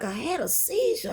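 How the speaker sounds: high, soft, stunned repeat; stunned disbelief. Retold or imitated. Waking in hospital, told of seizure, stunned high echo.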